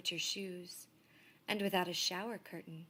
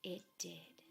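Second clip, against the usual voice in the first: ordinary delivery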